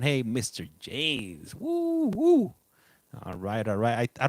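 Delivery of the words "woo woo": excited whoop. Real, not acted. Cheering a viewer's arrival.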